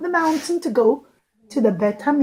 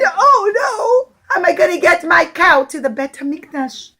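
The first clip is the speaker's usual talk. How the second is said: mock despair; 5 semitones higher, 4 dB louder than usual. Rich man despairs.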